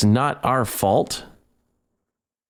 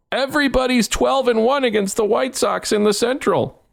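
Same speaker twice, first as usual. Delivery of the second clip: high sneering parody of the announcer; mock announcer; 13 semitones higher than usual. Mocking the Boston announcer, high sneer. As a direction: lampoon him, pitched way up. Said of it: "Don't sit there and go".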